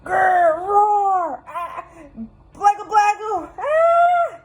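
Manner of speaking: high, loud playful monster growls; hammed-up monster shouting; monster roar. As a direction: roar like a silly monster trying to scare. Monster scares Sam.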